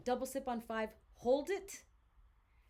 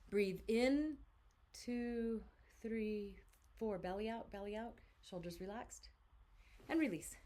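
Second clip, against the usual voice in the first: guided breathing count. Acted.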